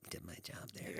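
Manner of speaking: unvoiced whisper, pleased with herself; whispered self-praise. Receptionist quietly congratulating herself, whispered. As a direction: breathe it out as a private pat on the back. Smug inner thought after delivering note.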